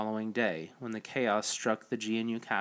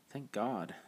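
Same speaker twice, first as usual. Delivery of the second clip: softly muttered relief; under her breath; 7 dB quieter than usual. Acted. Evelyn relieved under her breath at Noah. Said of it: "said under her breath".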